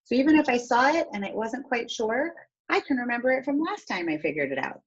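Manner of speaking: ordinary talk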